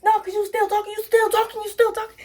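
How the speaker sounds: high insistent mock retort; retold heated retort. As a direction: repeat it like you won't let it go. Quoted argument, repeating herself.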